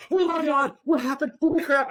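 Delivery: alarmed mom. Retold or imitated. Mother spots her son's bleeding head, high-pitched alarm. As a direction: gasp it out like a startled mother.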